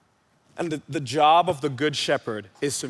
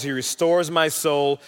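ordinary delivery

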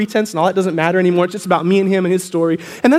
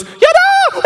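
whooping like a thrilled movie star; hammed-up celebration; acted cheering; 6 dB louder, faster than usual. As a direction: burst out in a triumphant whoop. Josh jumps up cheering in story.

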